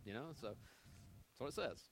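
usual speaking voice

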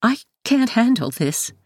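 high, upset mother's voice; tearful mother. A narrator's reading. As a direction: plead high, close to tears. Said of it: "Mom says tearily".

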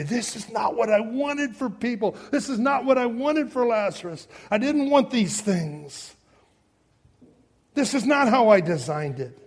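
Jesus lamenting. Retold or imitated. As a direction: voice Jesus grieving and angry. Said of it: "That's why he's so angry".

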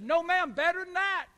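put-on bursting kid's pride; excited little boy. Boy voiced in story.

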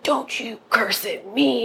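slow, drawn-out mock telling-off; retold indignant scolding. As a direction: play the offended guy, stretching each word. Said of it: "which pissed the guy".

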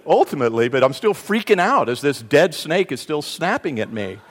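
usual speaking voice

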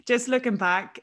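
normal talking voice

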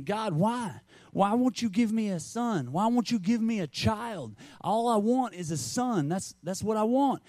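put-on high, pleading prayer of a childless wife; pleading cry to God. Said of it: "she was crying out".

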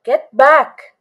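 loud, high shouted warning; urgent shout. A narrator's reading. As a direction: shout it out as a sudden alarm. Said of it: "someone shouted".

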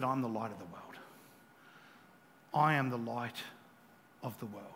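very low, soft, measured quote; quiet solemn declaration. Acted. Preacher quoting Jesus on the light of the world, solemn. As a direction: repeat it gently, with pauses.

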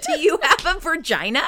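high, laughing tease; giggling playful question. Real, not acted.

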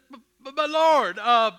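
loud stammering "But, but, but Lord"; stammering plea. Acted. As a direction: blurt it out in a panic. Moses pleads, stammering.

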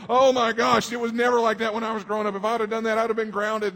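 hammed-up disapproving elder; grumbling old-timer. Pastor mimicking himself judging youth, mock exasperation. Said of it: "I look at these young people and I go".

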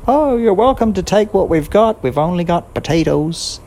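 high, sweet put-on innocence; high falsetto false innocence; sailors fooling pirates. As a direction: play it innocent to fool the pirates. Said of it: "they'd say".

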